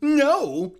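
high, loud shocked no; shocked cry. Acted. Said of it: "I uttered a shocked cry".

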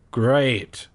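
plain reading voice

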